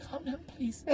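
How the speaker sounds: high, very soft mock plea; pleading sister. Sister begging the feminist to stop, imitated timid pleading. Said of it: "my sister's like".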